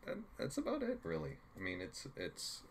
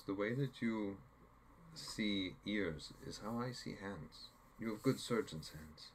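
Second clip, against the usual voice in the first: put-on soft, slow butchered Swedish accent; hint of Swedish accent, said gently; bad Swedish accent; 4 dB quieter than usual. Inga holding Hawkeye's hand, in a light Swedish accent. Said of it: "I'm butchering a Swedish accent".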